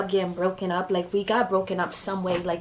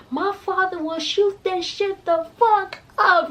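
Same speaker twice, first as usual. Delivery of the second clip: imitating Chrissy; 11 semitones higher, slower than usual. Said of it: "Chrissy over there like".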